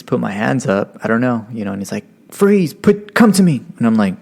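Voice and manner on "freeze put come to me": high-pitched mock police order; retold cop calling out, pitched up; imitated cop command. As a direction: order someone over as the cop. Acting the cop giving orders.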